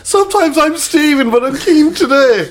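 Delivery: high, slow put-on voice; hammed-up innocent bloke; a lightly voiced imitation of the lad; mock earnest quote. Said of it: "and he went".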